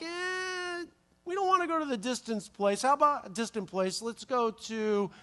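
high, reluctant mock-whine; whiny demons. Demons haggle with Jesus, high mock-whiny voice. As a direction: bargain like a sulky teenager. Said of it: "they're like".

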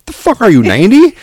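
loud, high-pitched mock outrage; acted incredulity. Dad challenges son over old-fashioned word, incredulous. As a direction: blurt it out in exaggerated disbelief. Dad acts out his disbelief.

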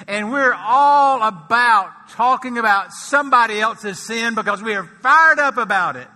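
loud, high, impassioned preaching; rising sermon fervour. Acted. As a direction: preach it out full force. Preaching at full force.

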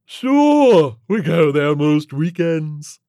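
loud, high, drawn-out alien voice; breezy alien creature. Acted. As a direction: brag casually, stretching the words.

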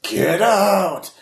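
high ghostly cry; scream shout. Acted. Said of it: "scream shouts in your head".

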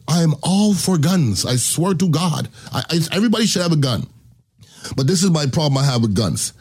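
ordinary talk

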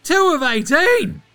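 high, loud, drawn-out disbelief; outraged exclamation. Real, not acted. Host appalled at two of eighteen, outraged. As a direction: cry out the awful stat, slow and high.

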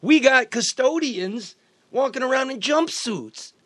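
loud, high, exasperated ranting; heated rant about missing supplies; indignant rant. Real, not acted. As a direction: vent the outrage at full voice.

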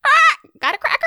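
high, fast parrot squawk. Acted. Parrot begs for cracker.

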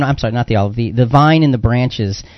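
usual speaking voice

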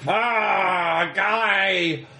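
high, hammed-up cry of frustration; wailing villain. Industrialist left tied to the tree, whiny wail. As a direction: wail like a thwarted cartoon villain.

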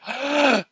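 sharp, high intake of breath; acted gasp. Onlooker winding up to scream at her, mock gasp. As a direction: gasp as if about to scream back. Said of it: "and went".

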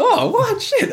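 retold surprise; shocked. His own shock retold.

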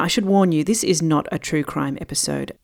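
ordinary talk, nothing marked

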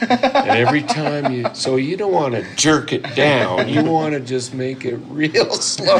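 put-on high, loud American old-timer; hammed-up American mentor, drawing out the advice; American accent. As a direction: imitate the old American teacher at full voice. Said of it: "I'm doing your American accent".

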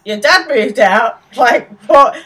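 mock-baffled, as if this never came up; shocked disbelief. Will hearing his mate's dad left, stunned. Will's shocked reaction retold.

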